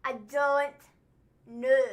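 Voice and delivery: loud "don't", pause, then "know"; corrective demo. Shown as a demonstration. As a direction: separate "don't" and "know" to teach it. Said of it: "You're not saying it right".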